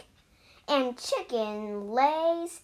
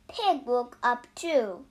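plain reading voice

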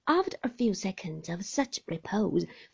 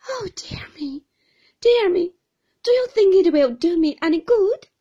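higher and louder, a pleading wail; helpless cry; 11 semitones higher, 13 dB louder than usual. Acted. Helpless young mother asks the aunt if tea will help, pleading. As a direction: plead helplessly near tears. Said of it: "cried my mother in a helpless manner".

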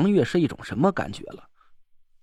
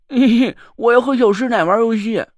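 put-on gleeful child voice; 5 semitones higher, 5 dB louder than usual. Mud doll giggles, wants play.

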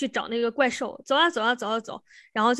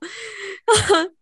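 short laugh; 9 semitones higher, 4 dB louder than usual. Real, not acted. Laughs at her own comment.

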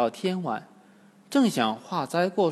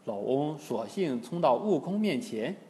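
ordinary delivery

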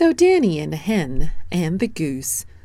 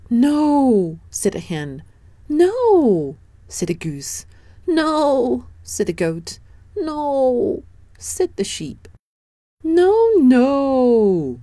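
emphatic no; 8 semitones higher, 4 dB louder, slower than usual. Acted. Animals refuse in turn.

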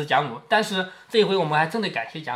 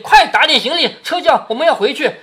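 raised, higher-pitched command; giving orders; 6 semitones higher, 5 dB louder than usual. A narrator's reading. Grandmother ordering servants to pack up and leave, commanding. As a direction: order the servants about, a little louder. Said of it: "一面指令".

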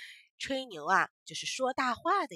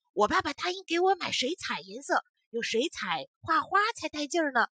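put-on boastful child voice; 6 semitones higher, 5 dB louder than usual. Boy bragging about paints.

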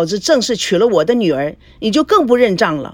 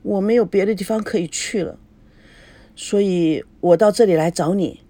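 plain reading voice

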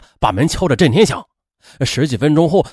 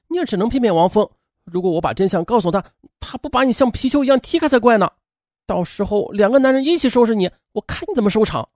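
higher, scornful taunt; sneering retort; 6 semitones higher than usual. A narrator's reading. Friend taunts the cheating wife, higher scornful voice. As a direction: taunt her with cold scorn. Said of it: "杨桃冷笑着回击".

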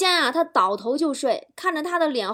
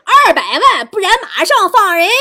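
very loud, high shouted threat; hammed-up villain bellowing an ultimatum; kidnapper; 6 semitones higher, 12 dB louder than usual. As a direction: yell the demand at full blast. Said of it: "绑匪说".

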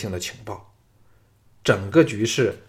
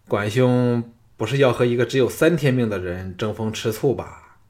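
ordinary delivery

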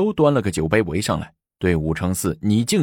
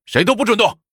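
high, forceful freeze order; shouted command; 13 semitones higher, 4 dB louder, faster than usual. A narrator's reading. Soldiers surround the revellers, shouted command. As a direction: pitch thrown right up to halt the room. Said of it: "齐声喊道".

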